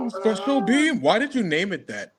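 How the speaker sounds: high put-on voice asking "Special beam?"; mock voice, "beam" falling; mocking question. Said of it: "He said".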